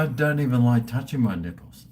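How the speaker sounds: ordinary delivery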